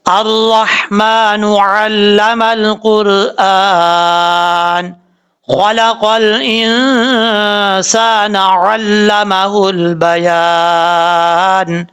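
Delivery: very slow melodic tajweed chant; Quran recitation. Shown as a demonstration. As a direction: chant each word long and even. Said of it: "اب ہم اس line کو پڑھتے ہیں sound میں". (بہت آہستہ خوش الحانی سے تلاوت)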